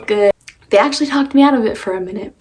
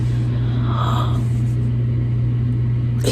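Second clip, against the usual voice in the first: shocked gasp. Real, not acted. Sees dyed hair.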